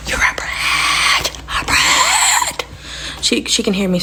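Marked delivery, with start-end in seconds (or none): 0.1-2.6 hushed, slow whisper of brat; whispered insult. Real, not acted. Sibling muttering about the toddler, secret whisper. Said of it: "so I'm trying to, you know, be quiet".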